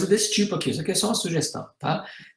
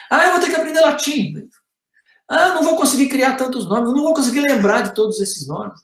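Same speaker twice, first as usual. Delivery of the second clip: higher-pitched imitation of grumbling objectors; mock complaining; 7 semitones higher than usual. Imitating virologists objecting to Latin names, mock complaining. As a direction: complain in a raised, put-on protest. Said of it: "essas três reclamações aqui".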